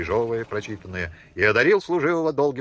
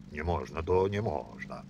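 softer, slow echo of не можно, half to himself; muttered echo; 7 semitones lower, 7 dB quieter than usual. Acted. Filya echoing his father's refusal, muttered quietly. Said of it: "бормочет Филя".

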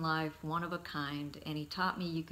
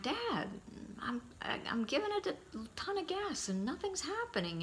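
much higher, flustered plea; exasperated kid; 12 semitones higher, 3 dB quieter than usual. Retold or imitated. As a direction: sound like a kid out of his depth. Frustrated call to Dad.

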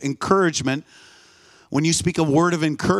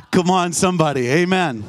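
laughing rally; 2 semitones higher than usual. Real, not acted. Preacher rousing the congregation after the punchline, laughing. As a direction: lift the room with a laughing cry.

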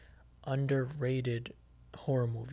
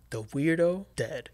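ordinary delivery

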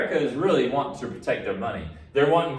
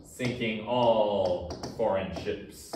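slow dictation; 3 semitones lower, 4 dB quieter, much slower than usual. Shown as a demonstration. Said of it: "dictating notes".

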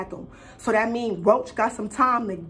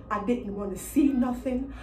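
usual speaking voice

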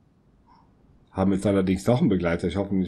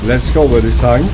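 loud hype; 4 semitones higher, 12 dB louder than usual. Real, not acted. Starting the run in game.

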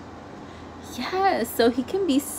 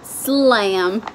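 ordinary delivery

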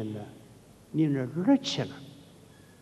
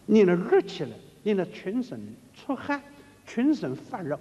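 excited; 7 semitones higher than usual. Acted. Fervent description of heated chanting.

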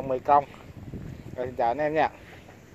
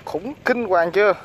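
amazed; 6 semitones higher, 6 dB louder than usual. Real, not acted. Showing off the big fish.

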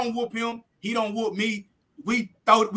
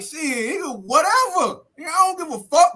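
higher and louder, brushing it off; defiant outburst; 6 semitones higher, 3 dB louder than usual. Retold or imitated. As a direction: throw it out loudly, like nothing can scare you. Defiant reply before a rematch.

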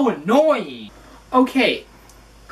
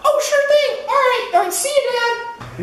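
put-on teen girl voice; 13 semitones higher than usual. Daughter voice, high.

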